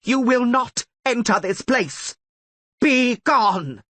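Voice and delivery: high, loud banishing shout; commanding scream. Acted. Tissaia banishing the attackers, a loud high scream. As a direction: drive them off with the voice. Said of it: "she screamed again".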